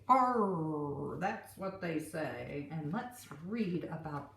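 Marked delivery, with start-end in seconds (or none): 0.1-1.2 gruff pirate growl; a playful pirate arr for the kids. Pretend. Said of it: "what do the pirates say? They say".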